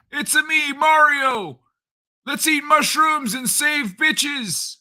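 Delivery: very high cartoon falsetto plumber; Mario. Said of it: "Old [name] Mario voice?".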